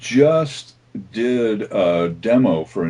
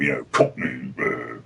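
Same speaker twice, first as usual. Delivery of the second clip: Cockney Pudge voice; 8 semitones lower, 4 dB quieter than usual. Shown as a demonstration. Voice actor demoing Dota's Pudge, a low Cockney brute. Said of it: "Pudge on Dota Two is this sort of".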